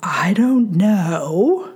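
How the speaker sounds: slow, drawn-out "I don't know"; dragged-out, rising kid's excuse; imitated kid's shrug. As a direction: stretch it out like a clueless kid. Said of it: "the ever familiar line of".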